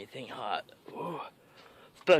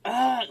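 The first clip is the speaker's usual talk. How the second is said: pained cry; 12 semitones higher, 5 dB louder than usual. Real, not acted. Pepper pain cry.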